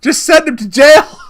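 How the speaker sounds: high, loud comic shout; mock outburst. Joking interpreter wanting the suspect jailed, in a mock shout. Mock verdict after laughing.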